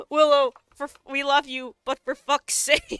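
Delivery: loud, laughing exasperation; laughing mock scold. Streamer scolding her own character, laughing exasperated. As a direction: scold Willow while cracking up.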